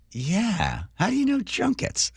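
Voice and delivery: high-pitched imitated astonishment; surprised recognition. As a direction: ask it in high, startled disbelief. Acting clerk's surprise at his knowledge.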